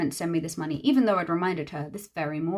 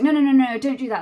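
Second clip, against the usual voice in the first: louder, hurried no-no-no; mock panicked protest; 4 semitones higher, 7 dB louder than usual. May stopping her from contacting the bride, louder flustered protest. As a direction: imitate May rushing to stop her. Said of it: "And she's like".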